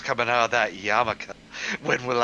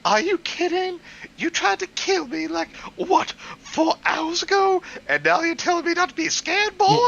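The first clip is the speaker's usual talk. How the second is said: put-on much higher, indignant girl voice; high mock girl voice. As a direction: squeal it like an offended teen girl. Mock girl voice reading story.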